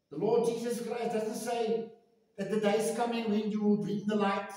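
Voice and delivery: ordinary delivery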